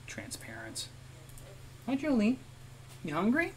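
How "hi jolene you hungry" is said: high, cooing pet-talk voice. Real, not acted. Talking sweetly to pet Jolene.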